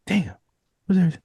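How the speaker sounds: high, surprised outburst; a mocking squeal of shock; mock surprise. Acting out a character's line.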